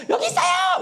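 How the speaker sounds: very high acted scream; hammed-up, high-pitched cry for attention; beggar's shout. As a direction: yell to be noticed in a crowd. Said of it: "막 이런 식으로".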